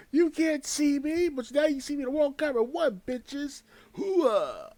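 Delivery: high, slow yelled wrestler catchphrase; loud gung-ho soldier. Retold or imitated. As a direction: bellow it like a hyped-up wrestler. John Cena catchphrase impression, shouted.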